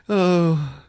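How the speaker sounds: ordinary delivery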